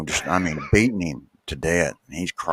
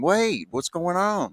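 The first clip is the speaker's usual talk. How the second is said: mock yell; 12 semitones higher, slower than usual. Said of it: "she yells at him".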